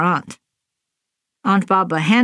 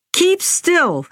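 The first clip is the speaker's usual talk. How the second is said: high shrill-pitched mock scream; a mother screaming at her child; 11 semitones higher than usual. A narrator's reading. Nyang to her kicking daughter, a high mock scream. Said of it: "Nyang screamed futilely".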